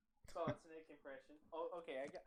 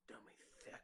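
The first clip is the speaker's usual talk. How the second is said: whispered; 9 dB quieter, much faster than usual. Pretend. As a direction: whisper dummy thick softly. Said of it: "I just want to say the words".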